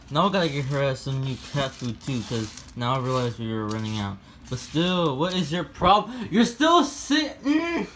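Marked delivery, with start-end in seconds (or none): 5.2-7.9 high, slow, loud outrage; high outraged yell at the cat. Real, not acted. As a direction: shriek at the lazy cat. Yelling at cat.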